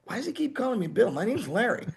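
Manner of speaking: high, fast, puzzled bandmate; high quick puzzled voice of a bandmate; confused Larry. Retold or imitated. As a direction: squeak it out fast, baffled. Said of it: "Larry'd be like".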